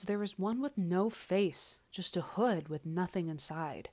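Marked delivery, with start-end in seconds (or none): none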